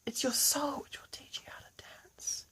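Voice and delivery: hushed mystical whisper; soft guru whisper; whispered wisdom. Acted. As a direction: breathe it like a sacred secret. Pennywise's wise final lesson.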